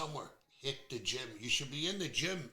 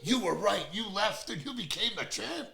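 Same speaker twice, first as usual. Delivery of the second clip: higher and laughing, amazed; laughing gym teacher; 8 semitones higher, 5 dB louder than usual. Retold or imitated. Host retelling the gym teacher's reaction, laughing higher. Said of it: "the gym teacher goes".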